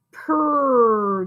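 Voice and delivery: "Purr" drawn out to show the P R blend; sounding out pr. Shown as a demonstration. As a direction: isolate the P R sound. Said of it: "Did you hear that P R?".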